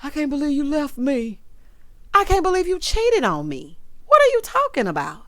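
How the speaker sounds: high, loud mocking whine of a caught cheater; hammed-up cheating husband, rising to a squeal; mock surprised cheater. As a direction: squeal it like a man who can't believe she left. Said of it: "get surprised like".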